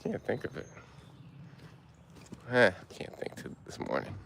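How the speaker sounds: whispered to himself; whispered aside. Real, not acted. Hiker whispers to himself while trying to remember, whispered. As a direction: drop to a whisper, thinking aloud. Muttering to himself mid-hike.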